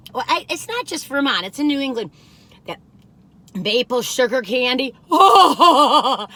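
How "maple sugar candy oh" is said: laughing loudly with delight. Real, not acted. Cookie reviewer laughing over maple sugar candy, loud and delighted. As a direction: gush and laugh at the thought.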